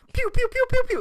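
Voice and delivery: high, loud "pew" noises; rapid piercing toy-laser sound effect; imitated laser zaps. Telling co-host about ride lasers, imitated zaps.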